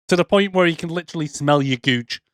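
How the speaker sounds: ordinary talk, nothing marked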